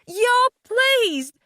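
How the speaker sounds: high, loud outraged shout; shouting in anguished fury; accusing shout. A narrator's reading. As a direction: hurl the accusation at him. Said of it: "I shouted at him".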